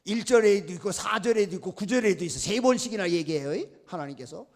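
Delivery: ordinary talk, nothing marked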